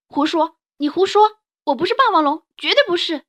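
put-on high, loud furious child shout; angry child denial. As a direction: yell the denial in a rage.

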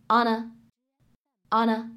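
"on a" slowly linked as "ona"; linked reading. Shown as a demonstration. Teacher demonstrates linking "on a" for students. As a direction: join "on a" smoothly into one word. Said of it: "可以读成".

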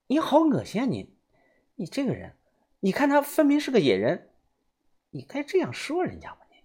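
put-on a girl chiding her crude friend; young woman scolding. A narrator's reading. 小轩 scolds 豆芽仔.

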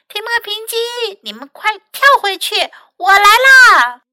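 high-pitched urgent call; shouting. A narrator's reading. Mac warns Tim and Pinky to jump back, shouting. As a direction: call out high, swelling to a loud finish. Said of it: "麦克大喊道".

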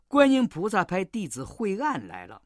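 usual speaking voice

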